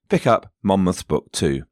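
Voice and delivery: usual speaking voice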